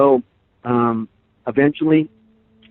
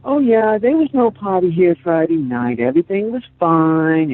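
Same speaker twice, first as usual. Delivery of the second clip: high, loud sing-song mock of a breezy lie; sneering impression of the mother's casual denial; mocking imitation; 8 semitones higher, 5 dB louder than usual. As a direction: imitate her, falsely cheerful and much higher. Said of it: "she's like".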